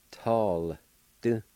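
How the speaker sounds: Tall and Du split by a pause; syllable by syllable. Shown as a demonstration. Teacher repeating the word for learners, syllable by syllable. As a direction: sound out Tall, then a short Du. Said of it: "pronounce the L sound with the T A like this".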